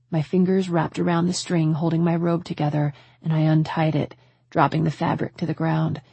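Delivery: plain reading voice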